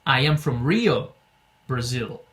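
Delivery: slow, clear model answer; slow example answer. Shown as a demonstration. Teacher models another example answer slowly. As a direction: say it slowly for practice. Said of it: "Você pode responder".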